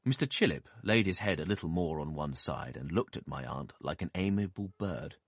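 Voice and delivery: plain reading voice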